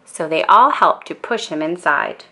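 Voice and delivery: usual speaking voice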